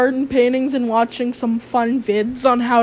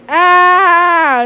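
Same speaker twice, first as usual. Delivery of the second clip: awkward exclaim; 5 semitones higher, 8 dB louder than usual. Retold or imitated. Awkward reaction.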